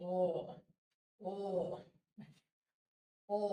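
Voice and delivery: "অ" held long as a deep sound. Shown as a demonstration. Teacher modelling the deep sound, long "অ". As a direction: draw each "অ" out from deep down. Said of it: "এটা deep সাউন্ডে রয়েছে".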